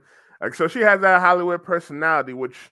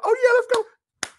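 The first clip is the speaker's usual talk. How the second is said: much higher, hyped-up cheer; mock excited; 5 dB louder than usual. Reviewer imitating the hyped trailer characters, high excited squeal. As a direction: play up trailer hype with a squeal. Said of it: "you see them excited".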